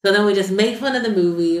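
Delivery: ordinary talk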